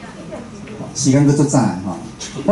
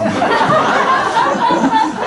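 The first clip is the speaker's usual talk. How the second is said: a high, bright burst of laughter at the joke; amused laugh. Real, not acted. Laughs at the sign-up numbers. (忍不住高声大笑)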